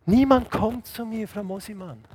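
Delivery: high, plaintive voice fading away; lonely complaint trailing into softness; lonely old patient. Retold or imitated. Old patient to nurse, lonely and plaintive. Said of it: "Die alten Leute sind sowas von einsam".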